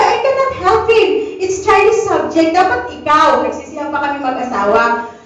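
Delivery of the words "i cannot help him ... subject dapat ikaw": higher, louder blame on the husband; raised defensive voice. Retold or imitated. Wife to husband over blame, raised and defensive. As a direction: argue back at him, voice up.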